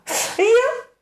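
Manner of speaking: ordinary delivery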